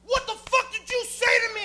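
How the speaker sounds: high-pitched mock-furious demand; enraged confrontation. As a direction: burst in spoiling for a fight. Enraged woman bursts in, retold.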